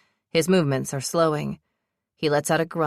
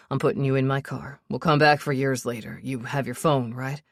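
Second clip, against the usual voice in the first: a hint of a lower man taking charge; steady, quick instructions in his slightly lower voice; lightly voiced Sebastian, urgent; 3 semitones lower than usual. Sebastian giving orders while running.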